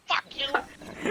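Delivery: high, held-back angry comeback; mock angry retort. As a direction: imitate a small mad cousin without raising volume. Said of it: "she gets all mad".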